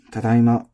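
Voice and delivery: tadaima said level and loud; flat tadaima. Shown as a demonstration. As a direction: keep tadaima even. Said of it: "this is also flat".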